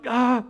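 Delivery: mock sob of relief, high and loud; a short, hammed-up gasp of sobbing joy; imitated relieved sob. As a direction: let out a child's choked sob of relief. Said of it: "feel the sob".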